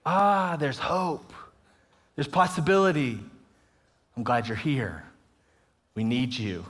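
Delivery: quiet, low, heartfelt reassurance; soft, low and tender. Retold or imitated. Speaker telling the audience young people matter, tender and low. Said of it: "we see that number and we say".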